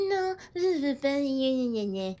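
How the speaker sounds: high mocking imitation of a sulky child; aunt mimicking her niece's whiny refusal; mocking whine. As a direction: parrot the niece's whining to tease her. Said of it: "tu étais genre".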